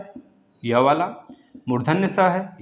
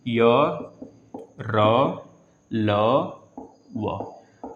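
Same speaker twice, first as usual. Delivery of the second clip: normal talking voice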